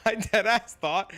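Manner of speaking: can barely get it out for laughing; giggling while retelling the mix-up. Real, not acted.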